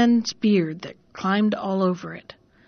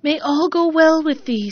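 raised, louder kindly blessing; fence blessing; 6 semitones higher, 4 dB louder than usual. A narrator's reading. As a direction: bless her as she goes. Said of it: "said the fence".

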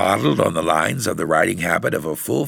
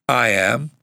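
put-on higher-pitched child speaking up; defiant; 6 semitones higher than usual. A narrator's reading. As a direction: stand up for yourself. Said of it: "Margaret spoke up defiantly".

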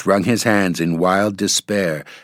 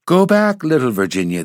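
very high warning call, then dropping; crying out; 6 semitones higher than usual. A narrator's reading. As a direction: call out high to turn her back. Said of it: "they cried".

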